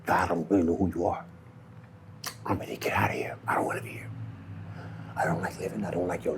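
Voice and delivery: hushed, despairing whisper to God; soft whispered plea; whispered prayer. Retold or imitated. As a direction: breathe it out like a last plea.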